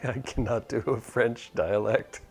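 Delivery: laughing through the words; chuckling self-consciously. Real, not acted. Laughing at own accent.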